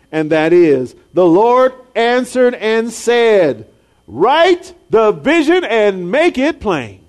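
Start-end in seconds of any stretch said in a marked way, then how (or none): 1.1-7.0 slow, loud, weighty proclamation; slow solemn reading of the verse; solemn scripture reading. Acted. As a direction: proclaim each word like God's answer. Preacher reads scripture solemnly.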